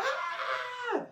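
high laughing cheer, pulled back in volume; mock crowd cheer. Telling friends how everyone reacted, soft laughing mock cheer. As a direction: squeal like the crowd going wild.

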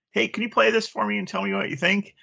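high-pitched coworker calling over; lightly acted, high eager ask; imitated coworker request. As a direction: pitch it up like a colleague asking a favor. Coworker heard over cubicle wall.

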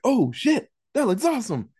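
high, eager excited exclamation. Retold or imitated. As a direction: gush like you just found a great movie. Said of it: "And he's like".

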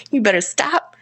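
normal talking voice

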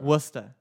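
Worcester as WUSS-tuh, two syllables; Worcester shortened to WUSS-tuh; local pronunciation. Shown as a demonstration. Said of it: "You're not saying it right".

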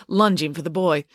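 ordinary delivery

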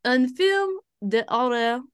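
"Un film de horror" drawn out slowly, in a put-on French accent; mock French accent. Shown as a demonstration. Showing the app's French phrase, slowly in a mock accent. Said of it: "saying the genre with a bit of an accent".

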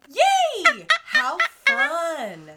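loud, high squeal of delight; excited cheer. Real, not acted. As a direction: cheer for the friend's news, way up high. Friend announces birthday trip.

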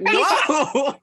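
high, laughing whoa; amazed whoa. Retold or imitated. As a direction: act stunned and laugh it out. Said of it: "And I was like".

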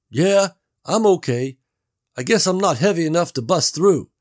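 Gary calling through the wall; Gary's voice. A narrator's reading.

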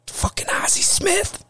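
urgent stage whisper; breathless whisper at ringside. Retold or imitated. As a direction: hiss it under your breath, starstruck. Whispering at fight in ring.

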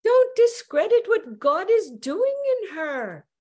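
a wife's high, loud rebuke to her husband over the phone; Martha interjecting. Retold or imitated. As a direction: jump in sharply to defend her. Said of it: "And Martha on the other line, she goes".

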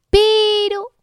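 sudden high squeak; surprised squeal. Acted. Tree's sudden idea.

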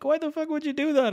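high-pitched mock disbelief; incredulous buddy. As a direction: question your friend in a high, baffled voice. Buddy's disbelieving reproach, retold.

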